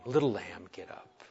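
low and very soft, fading to a whisper; hushed, sweet coaxing; tender wake-up call. Acted. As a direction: gently wake a little child. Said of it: "Sweet little wake-up call".

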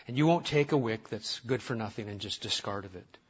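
soft, low, earnest prayer; pastoral prayer. Acted. Pastor praying for his church, soft and earnest. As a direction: pray humbly over the church.

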